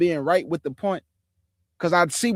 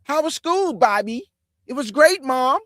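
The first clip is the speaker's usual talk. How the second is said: high, louder put-on mom-and-son chat; pitched-up, squeaky-clean family parody; 8 semitones higher, 5 dB louder than usual. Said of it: "Soon you get around your mama and dad".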